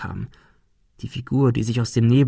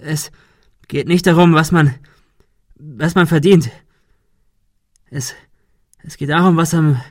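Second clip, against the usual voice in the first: higher, louder voice with broken starts; halting, embittered man; 4 semitones higher, 5 dB louder than usual. Acted. Man arguing about what is left in the end, halting and embittered. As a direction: push the words out, stopping and restarting.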